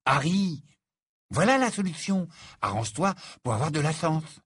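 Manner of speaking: high, eager exclamations; excited brainwave. A narrator's reading. Ron telling Harry he has the solution, excited. Ron blurts out his sudden idea.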